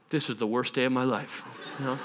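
low, soft, crushed mumble; deflated aside. Retold or imitated. Nephew watching uncle fail, deflated. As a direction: say it under the breath in mock despair.

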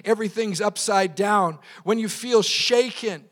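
projected, emphatic sermon delivery; fervent preaching. Real, not acted. Pastor urging the congregation to stand firm, fervent preaching. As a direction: build rhetorical questions into ringing scripture. Preaches with rhetorical questions and scripture.

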